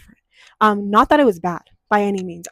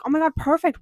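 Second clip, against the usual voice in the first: mock excited; 7 semitones higher than usual. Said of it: "So I was like".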